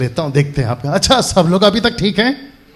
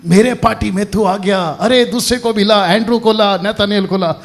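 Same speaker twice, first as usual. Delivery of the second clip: higher-pitched, mock party-leader praise; imagined Jesus recruiting; 4 semitones higher than usual. Preacher imagining Jesus gathering disciples for his party, higher mock-boss voice. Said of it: "अब ये बोलेगा".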